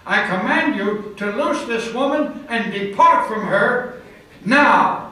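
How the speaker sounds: building to a loud final now; commanding shout. Retold or imitated. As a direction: order the demon out, hit now hardest. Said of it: "And I shouted back in the demon".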